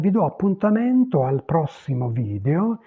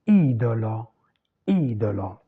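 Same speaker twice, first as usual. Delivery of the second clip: "Idolo" stressed on the opening I; 4 semitones lower than usual. Shown as a demonstration. Teacher showing which vowel carries the accent, stress on I in "Idolo". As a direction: lean on the first syllable of "Idolo", low after it. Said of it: "qui porte l'accent".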